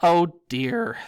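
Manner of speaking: high, dismayed sigh of words; sad. A narrator's reading. Girl watches the weeping ghost flee, sadly. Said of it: "said Hermione sadly".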